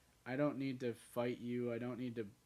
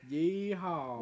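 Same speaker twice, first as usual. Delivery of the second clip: cowboy yeehaw; 7 semitones higher, 6 dB louder than usual. Pretend. Cowboy bit, yelling yeehaw.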